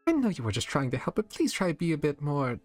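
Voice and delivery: high, prim scolding voice; refined disapproval; posh stern lady. Acted. Rarity scolding.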